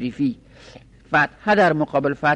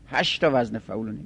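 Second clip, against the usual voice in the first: ordinary talk, nothing marked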